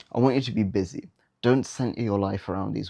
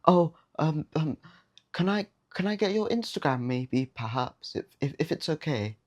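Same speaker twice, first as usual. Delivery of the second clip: high, hesitant, halting mock ask with ums; timid hesitant ask; 7 semitones higher than usual. Shown as a demonstration. Shy guy nervously asking a girl for her Instagram, timid stammer. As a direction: stammer it out like a scared kid asking permission. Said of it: "How much better does that sound rather than".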